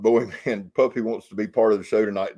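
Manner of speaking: normal talking voice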